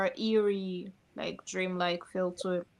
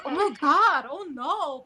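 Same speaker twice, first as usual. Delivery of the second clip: higher, louder put-on "oh no" reaction; mock dismay; 5 semitones higher, 6 dB louder, slower than usual. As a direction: feign being upset at bad news. Retold feigned dismay on phone.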